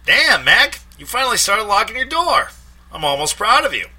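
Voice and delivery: high, loud ribbing; teasing Mike. A narrator's reading. Mike teasing Mac about locking his door, high and loud. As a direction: needle your friend.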